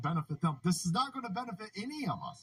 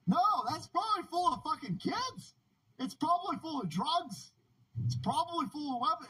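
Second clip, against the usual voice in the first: heated rant; 10 semitones higher than usual. Real, not acted.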